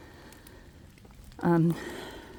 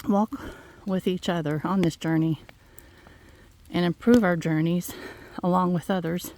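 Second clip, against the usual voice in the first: ordinary talk, nothing marked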